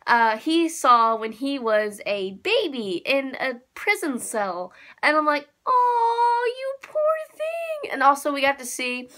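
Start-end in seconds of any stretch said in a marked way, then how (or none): 5.7-7.9 high, drawn-out sympathetic coo; cooing pity. Retold or imitated. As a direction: pity it like a little baby. Pities the character as a baby.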